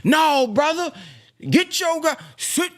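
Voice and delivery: hammed-up strict captain giving orders; stern captain. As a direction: play the strict captain barking a mild command. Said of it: "The captain's supposed to be".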